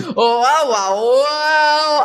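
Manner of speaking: drawn-out whoa, rising; mock amazement. Mock shock at the kiss.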